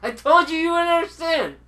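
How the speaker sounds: loud, high frustrated yell; exasperated shout. Real, not acted.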